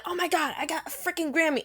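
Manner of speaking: high, thrilled squeal; excited. Retold or imitated. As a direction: gush like you just won. Excited Grammy winner quote.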